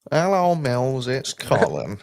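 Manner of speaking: a friend's put-on accent, greeting Mills; mock Colin calling in; imitating Colin. Copying his mate's Colin impression back at him. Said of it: "the easiest man to do the accent for".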